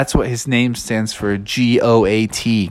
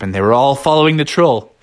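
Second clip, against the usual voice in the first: ordinary delivery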